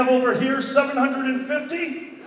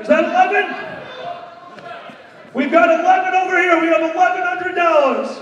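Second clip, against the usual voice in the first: auction calling; 4 semitones higher than usual. Real, not acted. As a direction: stretch out going once, going twice.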